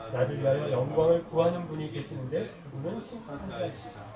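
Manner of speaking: low, loud, even read-aloud voice; scripture recitation. Acted. Reads scripture aloud with congregation. (성경을 낮고 힘 있게 낭독)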